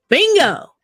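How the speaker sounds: high-pitched called-out "Bingo"; mock yell. Woman catching the speaker's slip, high retold yell. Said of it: "that transgender woman yelled out".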